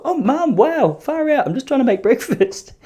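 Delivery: high, taken-aback laughing protest; mock shocked son. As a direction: flinch at mum's remark. Son reacting to mum.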